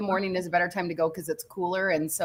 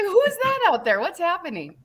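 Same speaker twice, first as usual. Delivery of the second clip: much higher, mock startled; mock surprise; 12 semitones higher than usual. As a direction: act startled at a figure outside. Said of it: "I was like".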